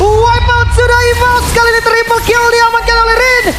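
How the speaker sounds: hyped, high, loud shout-casting; fighting game announcer. Real, not acted. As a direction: roar out the triple kill. Team wipe, caster hypes kill.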